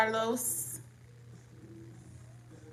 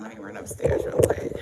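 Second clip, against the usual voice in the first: whispering; 2 semitones lower, 4 dB louder than usual. Real, not acted. Stepping away, whispers aside.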